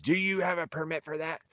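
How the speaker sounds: high mocking whine of a pushy bureaucrat; mocking the official. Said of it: "when he pointed at me and says".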